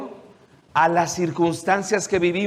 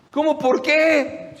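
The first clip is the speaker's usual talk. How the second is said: incredulous; 9 semitones higher, slower than usual. Acted. Disciples' incredulous reply.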